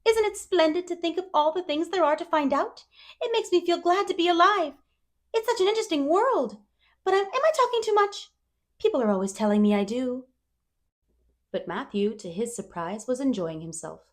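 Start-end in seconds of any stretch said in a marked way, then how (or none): 0.0-10.3 put-on higher, eager girl voice; dreamy chatterbox girl. A narrator's reading. Anne telling Matthew about dresses, wistfully eager.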